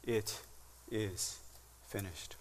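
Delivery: soft, slow, word by word; slow soft finality. Acted. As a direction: let each word fall into silence. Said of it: "a louder voice says".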